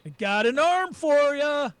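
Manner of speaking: high, drawn-out taunting holler; yelling. Acted. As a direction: holler it slowly to far-off enemies. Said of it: "I yell at that point".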